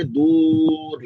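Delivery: दूर with a long stretched vowel; drawn-out emphasis. Shown as a demonstration. Teacher dragging the charge far away, drawn-out emphasis. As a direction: stretch दूर out to show great distance. Drags the word to show distance.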